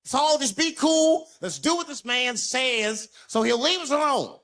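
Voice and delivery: loud, slow, high pleading; mock desperate reasoning; forced calm plea. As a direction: beg everyone to stay calm. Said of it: "I can't lose my cool".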